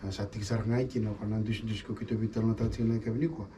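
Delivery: ordinary delivery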